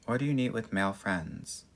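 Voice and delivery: ordinary talk, nothing marked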